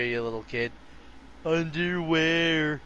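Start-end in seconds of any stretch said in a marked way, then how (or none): 1.4-2.8 slow silly voice. Pretend. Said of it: "I'll humor you".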